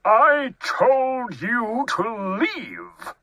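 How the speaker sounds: high, slow cry of an annoyed bear; indignant cry. A narrator's reading. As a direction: draw out each word in exasperation. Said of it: "cried Bear".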